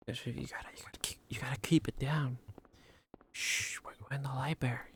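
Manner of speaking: hushed shushing whisper; librarian shushing whisper. Acted. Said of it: "Be quiet".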